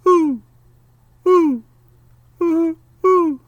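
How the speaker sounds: high loud hooting owl call; ringing owl hoot into the woods. Acted. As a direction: call out like a great horned owl. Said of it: "The sound of a great horned owl".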